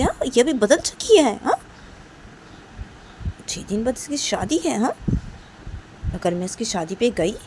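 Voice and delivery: high, loud angry ranting; fuming rant. Acted. Nisha in the car raging about the girl, furious. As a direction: spit out the outrage.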